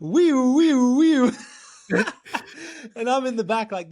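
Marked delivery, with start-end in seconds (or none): 0.0-1.3 high, loud wee-oo like an ambulance siren; playful siren noise, high and loud; siren imitation. 1.9-2.9 laugh. Real, not acted. Laughs after siren.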